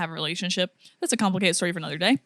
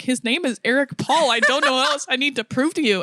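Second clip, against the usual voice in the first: higher, mock-tearful pleading; retold tearful insistence; 5 semitones higher than usual. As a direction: imitate crying in exasperation to be believed. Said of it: "And so I start crying".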